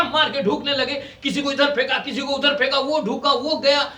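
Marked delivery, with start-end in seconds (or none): none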